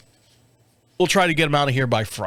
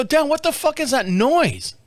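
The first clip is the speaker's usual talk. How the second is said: startled outburst; 9 semitones higher than usual. Retold or imitated. Startled by strange noise.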